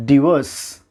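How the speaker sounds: டிவோர்ஸ் as di-VOSS, short I; correct divorce. Shown as a demonstration. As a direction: start டிவோர்ஸ் with dih, long O. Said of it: "சரியான pronunciation".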